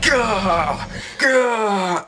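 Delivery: high, loud "gah" bursts; comic high-pitched yell of rage; mock angry shout. Imitating the angry bird gesture.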